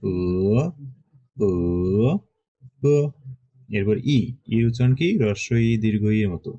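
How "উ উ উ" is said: উ প্রতিবার আলাদা টোনে; উ সমান, ওঠা, নামা-ওঠা, নামা; four tones on উ. Shown as a demonstration. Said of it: "উ এর উপরে ফার্স্ট টোন উ". (উ স্বরে চার টোন পরপর)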